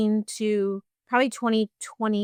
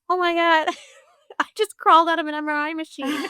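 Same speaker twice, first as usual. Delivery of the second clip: higher, louder, breaking into laughter; laughing disbelief; 7 semitones higher, 4 dB louder than usual. Retold or imitated. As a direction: laugh it off while still shaken. Calling her husband after panic.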